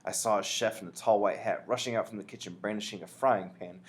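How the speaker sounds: ordinary delivery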